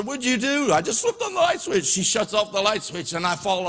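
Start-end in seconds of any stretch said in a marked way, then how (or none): 0.0-0.7 mock yell. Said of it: "she yells at my sister". 0.7-1.9 innocent sister. Retold or imitated. Sister answers mom defensively.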